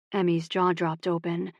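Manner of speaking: usual speaking voice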